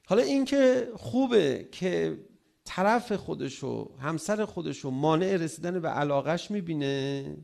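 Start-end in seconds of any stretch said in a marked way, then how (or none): none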